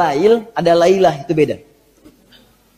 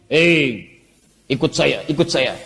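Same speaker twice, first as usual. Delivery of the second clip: lower, softer coaxing tempter; a hint of a tempting satan, softer and lower; luring devil; 7 semitones lower, 3 dB quieter, slower than usual. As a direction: coax him along like a sly devil.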